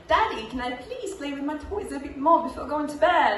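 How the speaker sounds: put-on high-pitched little-kid British accent; Peppa Pig. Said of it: "this is the Peppa Pig accent".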